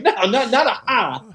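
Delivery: high laughing mock retort; mocking the singer's laugh. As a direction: laugh while throwing it back at him.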